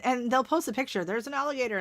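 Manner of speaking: usual speaking voice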